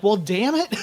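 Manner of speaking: high, laughing "damn it"; a rueful laughing curse; mock annoyed, laughing at himself; laughing mock frustration. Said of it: "And I'm like".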